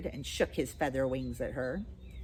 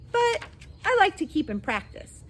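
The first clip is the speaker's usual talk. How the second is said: put-on high baby voice; 7 dB louder than usual.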